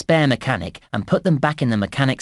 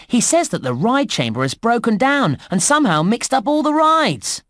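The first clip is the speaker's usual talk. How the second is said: alarmed report; 8 semitones higher than usual. Acted. Game guide reporting a broken ride chamber, mildly alarmed. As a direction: pitch climbing high on the bad news.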